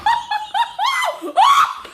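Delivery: very high, repeated frightened whoops; panicked whooping shrieks. Real, not acted. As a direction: let out a string of high hoots of fright. Shrieking as bird flies.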